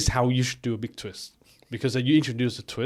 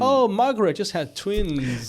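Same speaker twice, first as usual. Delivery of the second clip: higher, slow mock village gossip; gossipy chat; 8 semitones higher than usual. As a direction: coo it like small-town gossip.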